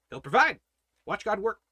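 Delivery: usual speaking voice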